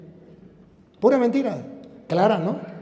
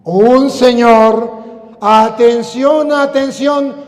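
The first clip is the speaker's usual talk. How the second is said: loud, drawn-out preacher's call; fervent preaching; 6 semitones higher, 10 dB louder, slower than usual. Acted. Preacher demanding the congregation's attention, fervent preaching.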